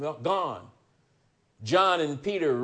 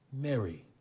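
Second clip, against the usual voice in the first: soft, much quieter name, gently spoken; familiar tone; 8 dB quieter than usual. Acted. Jesus gently calling Mary by name, soft and familiar. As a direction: say her name gently, like an old friend. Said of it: "in a familiar tone".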